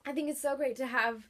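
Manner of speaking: real tears rising, a laugh breaking through; choked up while thanking the author; teary thanks. Real, not acted. Reviewer thanking the author, voice catching with real tears. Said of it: "I'm crying".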